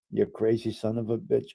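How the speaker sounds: usual speaking voice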